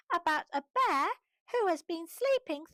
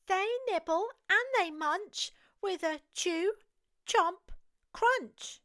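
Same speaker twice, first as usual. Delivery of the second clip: plain reading voice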